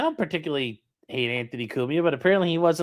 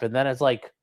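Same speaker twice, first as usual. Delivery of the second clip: ordinary talk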